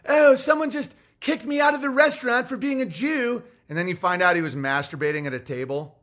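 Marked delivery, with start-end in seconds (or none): 0.1-3.4 much higher, louder whiny complaint; hammed-up self-pitying whine; mock victim voice. Mocking a complainer playing the victim, high whiny mock voice. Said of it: "they'll be like".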